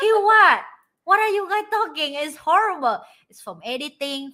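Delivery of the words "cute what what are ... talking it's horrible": high, loud indignant protest. Retold or imitated. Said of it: "And I was like".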